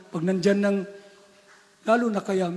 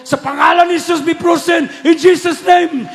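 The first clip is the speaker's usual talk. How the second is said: high, loud commanding prayer; retelling a bellowed prayer of command; shouted rebuke; 7 semitones higher, 10 dB louder than usual. Retold or imitated. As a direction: cry out the command to freeze him. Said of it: "hindi mapigilan yung bibig ko".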